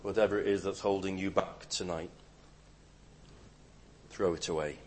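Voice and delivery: hushed appeal to the listener; quiet exhortation. Acted. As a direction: drop right down and urge them gently.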